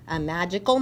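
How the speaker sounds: usual speaking voice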